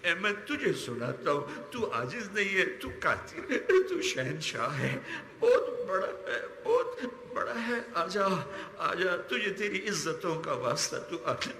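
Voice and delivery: real crying, voice pitched high and breaking; sobbing plea. Real, not acted. Exhausted preacher begs his Lord to come, sobbing. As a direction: cry out to God, collapsing into sobs. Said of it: "ہم بھی رو رو تھک گئے".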